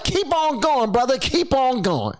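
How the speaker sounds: high-pitched mock crowd encouragement; cheering congregant. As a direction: egg the preacher on from the pews. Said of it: "They like".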